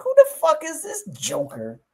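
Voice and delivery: a hint of indignant disbelief; retold outrage. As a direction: replay his annoyed thought, high and incredulous. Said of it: "I'm like".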